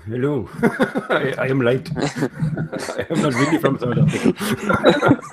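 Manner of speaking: put-on laughing, slow teasing Indian accent. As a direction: tease with a hammed-up Indian accent. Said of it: "You did your best Indian accent".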